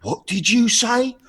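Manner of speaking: high, drawn-out Cockney taunt; Cockney impression. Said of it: "I really like the Cockney".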